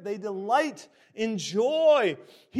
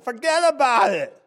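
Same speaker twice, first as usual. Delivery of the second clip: put-on loud, high New York accent; 4 semitones higher, 8 dB louder than usual. Preacher jokingly paraphrasing a verse to congregation, New York accent. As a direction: punch it out in your best New Yorker accent. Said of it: "My best New York accent".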